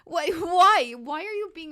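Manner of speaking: loud, high, laughing why; laughing exasperation. Real, not acted. Player yelling at a glitchy game, laughing and exasperated. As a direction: cry out at the game, laughing in despair.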